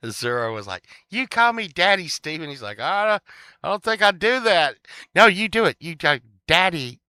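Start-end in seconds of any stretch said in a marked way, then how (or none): 1.1-2.5 high, loud comic order; mock demand. Azura ordering Steve in retold show, mock demand. 2.8-4.8 high, loud comic protest; mock reluctant refusal. Steve balking at Azura's request, mock refusal. 5.2-7.0 high, loud comic command; mock insistence. Azura insisting to Steve in retold show, mock insistence.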